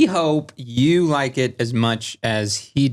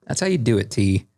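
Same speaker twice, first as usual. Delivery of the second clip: ordinary talk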